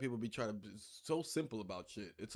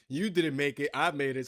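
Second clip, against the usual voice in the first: louder, accusing retort; indignant confrontation; 7 dB louder than usual. Pretend. Player confronting game mom over the art, indignant. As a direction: hit it hard like a playful accusation.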